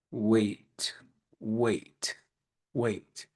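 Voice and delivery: low, soft, drawn-out repeated wait; mock drawn-out wait. Coach mocking how clients are told to wait, in a low drawn-out voice.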